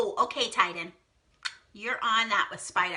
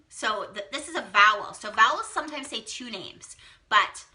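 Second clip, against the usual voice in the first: ordinary delivery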